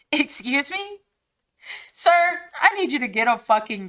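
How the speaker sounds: stunned, laughing excuse me; laughing disbelief. Real, not acted. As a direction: laugh out an outraged question.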